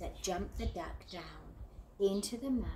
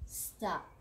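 ordinary delivery, nothing marked

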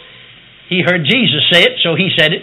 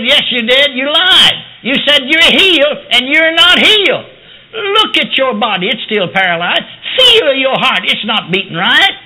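accusing devil; 7 semitones higher, slower than usual. Retold or imitated. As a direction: taunt him like the devil himself. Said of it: "This voice come. Said".

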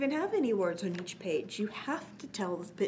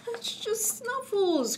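soothing grandpa; 12 semitones higher, slower than usual. Acted. Grandpa calming scared boy.

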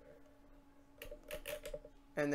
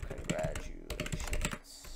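whisper; 12 semitones lower, 5 dB quieter, slower than usual. Real, not acted. Typing the on-screen message.

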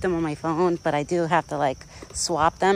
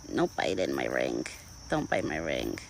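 low scold; 3 dB quieter than usual. Real, not acted. Scolding deer, much lower.

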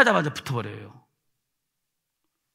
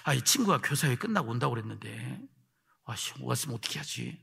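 low, hushed fretting; worried self-talk; 8 semitones lower, 9 dB quieter than usual. Retold or imitated. Teacher fretting about the roommate returning, quiet worried self-talk. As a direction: mull it over softly under your voice. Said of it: "가면서 걱정했어요".

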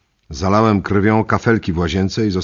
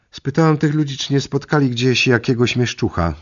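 calling over shoulder; 8 semitones higher than usual. Acted. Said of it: "krzyknęła przez ramię".

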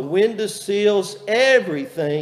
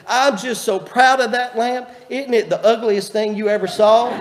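higher, louder, a hint of a gushing old lady; proud old lady; 6 semitones higher, 6 dB louder than usual. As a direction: beam with mock pride over the ugly gift. Proud foster mother about lamp.

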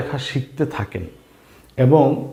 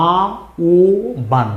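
আ ও বান split apart with pauses; broken into syllables for learners. Shown as a demonstration. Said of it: "এর উচ্চারণ হবে".

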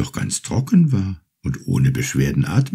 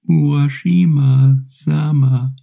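high, slow, small turtle voice calling his name; little turtle calling; 8 semitones higher, 4 dB louder, slower than usual. Acted. Grateful little turtle calls the fisherman from the sea, high and slow. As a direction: call up gently from the water like a tiny turtle.

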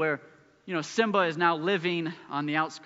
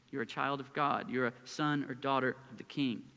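ordinary delivery

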